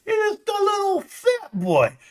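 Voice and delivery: very high, squeaky teasing voice; mocking baby-talk. Streamer belittling a viewer, high mocking squeak. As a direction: taunt in a drawn, tiny high-pitched voice.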